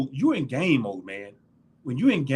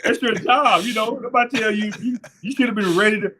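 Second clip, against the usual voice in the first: louder, laughing lecture; laughing scold; 8 semitones higher, 4 dB louder than usual. Retold or imitated. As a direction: scold him while cracking up. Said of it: "I said".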